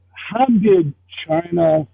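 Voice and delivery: muffled headset voice. Real, not acted. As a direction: sounds muffled, like through a wall. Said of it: "'Cause you sound muffled".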